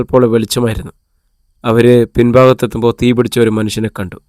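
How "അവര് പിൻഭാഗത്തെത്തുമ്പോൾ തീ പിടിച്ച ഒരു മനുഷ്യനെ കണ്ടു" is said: ordinary delivery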